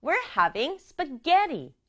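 We're having spaghetti said very slowly; slow model sentence. Shown as a demonstration. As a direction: read it slowly for learners. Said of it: "발음은 스파게티". (천천히 시범 문장)

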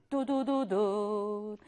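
slow, low imitated drumroll; suspenseful drum noise made by mouth; spoken drumroll. Imitated drumroll before story twist.

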